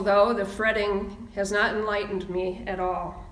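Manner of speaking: ordinary talk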